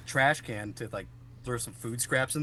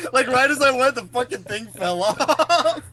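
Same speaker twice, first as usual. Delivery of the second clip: high, loud, laughing through words; laughing while talking; 11 semitones higher, 9 dB louder than usual. Real, not acted.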